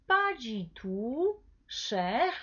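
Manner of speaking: "pas du tout cher" very slowly, stretched out; half-speed teacher's model of "pas du tout cher"; pronunciation model. Shown as a demonstration. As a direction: draw out "tout", pause, then a clear "cher". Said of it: "repita conmigo la pronunciación".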